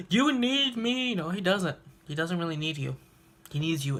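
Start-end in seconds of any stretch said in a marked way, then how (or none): 0.1-1.1 high, slow desperate cry of a villain; pleading villain. Retold or imitated. Evil spirit begging the hero, high desperate cry.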